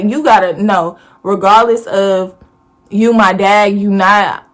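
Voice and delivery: ordinary delivery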